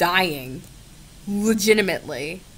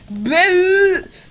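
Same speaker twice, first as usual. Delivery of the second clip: mock yell; 10 semitones higher, 6 dB louder than usual. Real, not acted. Yelling at the chatbot.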